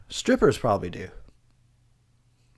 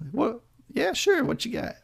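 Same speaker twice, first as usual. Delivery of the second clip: much higher, excited put-on voice; eager high voice; 13 semitones higher than usual. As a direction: squeak it like an eager guest.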